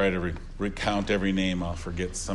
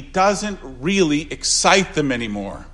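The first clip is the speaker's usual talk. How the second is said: usual speaking voice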